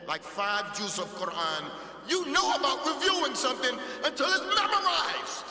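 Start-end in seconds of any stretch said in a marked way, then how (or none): 2.1-5.5 loud, high, swelling delivery; emphatic insistence. Real, not acted. Teacher explaining Quran review to students, loud and emphatic. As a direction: drive the point home at full projection.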